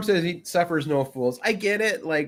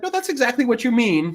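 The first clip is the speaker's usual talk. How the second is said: pointed Cleese retort; 9 semitones higher than usual. Retold or imitated. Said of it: "He's like".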